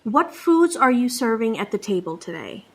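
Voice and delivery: ordinary talk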